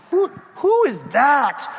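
high, stammered, slowed-down alarm; startled question. Acted. Crowd hearing a wail at the ceremony, startled question. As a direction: ask it as if startled by a distant cry.

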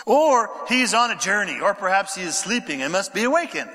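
ordinary talk

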